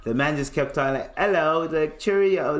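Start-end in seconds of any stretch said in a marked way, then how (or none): 1.2-1.7 higher, louder mock British hello; British accent. Friend keeping up the act for cops, British accent. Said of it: "kept talking in a British accent". 2.0-2.6 high mock-British farewell; British accent. Friend keeping up the act for cops, British accent. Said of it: "kept talking in a British accent".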